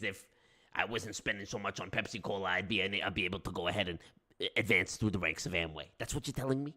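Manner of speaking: fast, low Godfather-style grievance; mobster accent. Pretend. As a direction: Don scolding a visitor at the wedding. Godfather parody.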